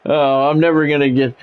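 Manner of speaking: ordinary delivery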